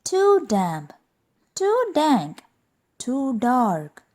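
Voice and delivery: high little mouse voice; small squeaky creature. Acted. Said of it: "Mouse squeaks".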